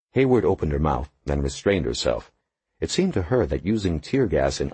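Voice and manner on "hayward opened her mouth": usual speaking voice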